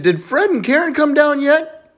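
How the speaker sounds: put-on high, slow childlike question; little boy. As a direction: ask Mom like an eager kid. Little boy asks his mom.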